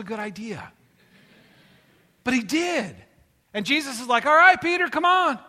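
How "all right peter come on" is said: loud, high-pitched call back; big retold holler, pitched up. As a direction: call him out onto the water. Jesus calls Peter out of boat.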